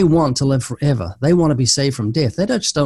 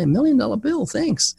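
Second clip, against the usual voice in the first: much higher, pleased thank-you from a passer-by; delighted stranger; 9 semitones higher than usual. Retold or imitated. Preacher mimics a stranger thanking him for a tract, voice pitched up. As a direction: chirp it back like a happy stranger handed a gift. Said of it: "and people say".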